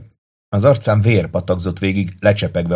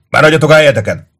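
high, loud command; bellowed order; 7 semitones higher, 7 dB louder, faster than usual. A narrator's reading. Septimus to the soldiers, bellowing. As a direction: yell to hold the men in place. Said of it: "üvöltötte Septimus".

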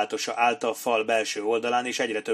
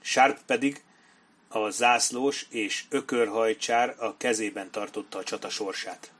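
ordinary delivery

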